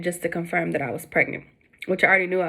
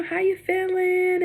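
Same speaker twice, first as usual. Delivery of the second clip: nurse voice; 11 semitones higher, slower than usual. Retold or imitated. Nurse asking during ultrasound.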